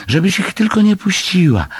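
high-pitched little animal squeak; squealed plea. A narrator's reading. Little animal begging her not to let go, high squeal. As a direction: squeak out an anxious warning. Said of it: "pisnął zwierzaczek".

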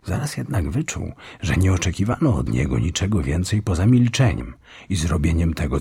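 usual speaking voice

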